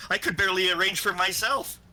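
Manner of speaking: high squeaky little voice. Acted. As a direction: pitch it up, small and squeaky. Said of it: "convey the squeaky high voice".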